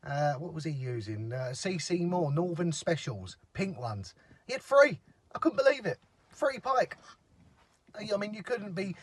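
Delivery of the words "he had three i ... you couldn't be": high, loud disbelief; amazed. Real, not acted. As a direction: exclaim it high and loud, astonished. Amazed at neighbour's catch.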